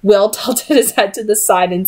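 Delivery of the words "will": plain reading voice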